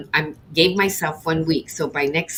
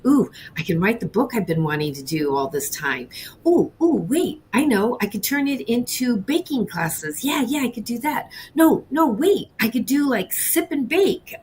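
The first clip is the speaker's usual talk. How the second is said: higher, bubbling over with new ideas; excited brainstorming; 4 semitones higher than usual. Retold or imitated. Baker reliving her rush of new business ideas, excited. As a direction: think aloud excitedly, each idea topping the last. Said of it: "I started getting happy".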